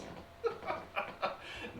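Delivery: derisive villain chuckle; scoffing demon laugh. Acted. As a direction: laugh off a silly theory. Demon scoffs at conspiracy theories.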